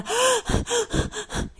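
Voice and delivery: series of big gasping breaths; breathless panting. Acted. Tomi rushing out of the water, panting gasps. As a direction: pant hard, acting out of breath.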